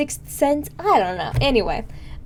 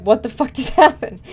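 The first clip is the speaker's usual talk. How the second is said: louder, laughing through the question; laughing retold quote; 6 dB louder than usual. Brother baffled by her sudden crying, retold laughing. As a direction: crack up mid-line retelling his reaction. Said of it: "And he's like".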